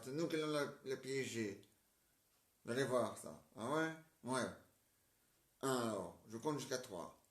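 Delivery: normal talking voice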